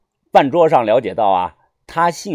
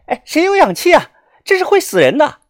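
high-pitched pressing appeal; anxious urgency; 13 semitones higher than usual. A narrator's reading. Young doctor calling to silent bystanders for oxygen, anxious urgency. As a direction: plead urgently as the doctor nobody answers. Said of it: "神色着急".

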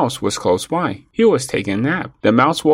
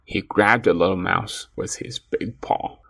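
usual speaking voice

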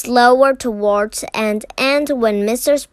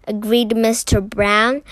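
usual speaking voice

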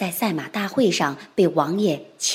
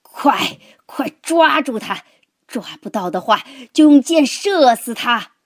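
urgent, higher shouted orders; loud roar; 4 semitones higher, 6 dB louder than usual. A narrator's reading. Thrown lord ordering men after the horse, roaring. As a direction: roar out commands in bursts. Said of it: "大声咆哮道".

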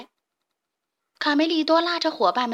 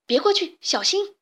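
urgent warning; 7 semitones higher than usual. Acted. Chicks warning each other.

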